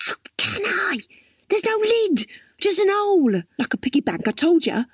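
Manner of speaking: high, protesting outburst; exasperated girl character. Acted. Crystal snaps back at Dwayne about the lidless pot, exasperated. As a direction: protest pitched right up, then settle into told-you-so.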